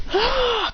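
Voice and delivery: short, high yell of effort; attack cry. Acted. Storyteller acts creating a fireball, high attack yell. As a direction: cry out while hurling power.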